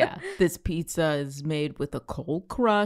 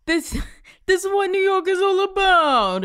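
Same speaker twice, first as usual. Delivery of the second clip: much higher, louder boastful New Yorker voice; mock proud New Yorker; 13 semitones higher, 5 dB louder than usual. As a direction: brag like a pizza-loving New Yorker. Proud pizza polluter, mock boast.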